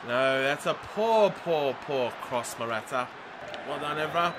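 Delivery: deflated disappointment. Real, not acted. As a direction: draw it out, dejected at the player. Lamenting a bad cross.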